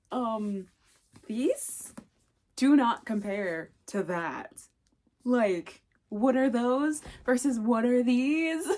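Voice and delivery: ordinary delivery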